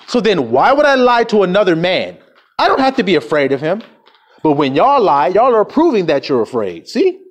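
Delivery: ordinary talk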